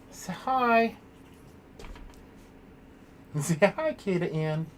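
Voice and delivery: high coaxing; pet talk. Real, not acted. Streamer asks her puppy to greet viewers, high pet talk.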